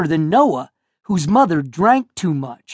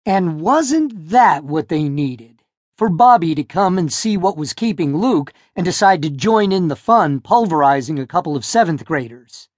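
usual speaking voice

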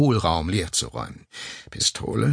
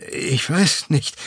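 confused; 7 semitones higher, slower than usual. Acted. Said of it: "versuchte es Gärtner verwirrt".

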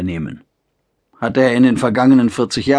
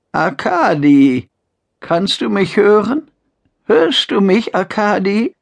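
put-on frail old mother; 9 semitones higher than usual. Old mother calling her son.

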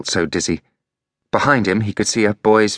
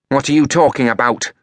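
hostile woman; 7 semitones higher than usual. A narrator's reading. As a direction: interrogate the boy coldly. Freya's voice.

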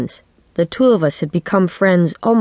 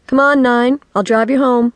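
higher, brisk motherly call; friend's mother; 7 semitones higher, 3 dB louder than usual. A narrator's reading. Mother offers her daughter's friend a lift, calling. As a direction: call in from the door, pitched up. Chris's mother calls into room.

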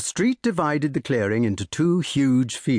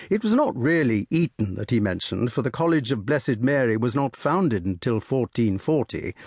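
usual speaking voice

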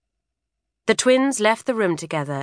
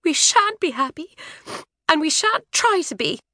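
higher, tearful sulk; trembling defiance; 11 semitones higher than usual. A narrator's reading. Isabel answering her father, tearful and defiant. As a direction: hold back tears, defiant. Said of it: "Isabel's chin shook".

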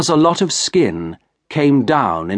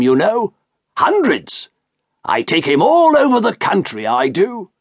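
higher, eager showman's voice; boastful shopkeeper; 7 semitones higher than usual. Acted. Shopkeeper boasting to the doctor about Merrick, higher eager showman. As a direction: sell the freak show to the gentleman.

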